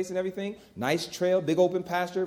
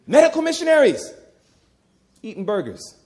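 incredulous outburst; 7 semitones higher, 8 dB louder than usual. Real, not acted. Incredulous outburst, then laugh.

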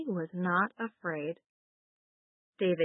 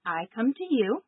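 leading call and repeat; 3 semitones higher than usual. Shown as a demonstration. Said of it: "Now let's say it together".

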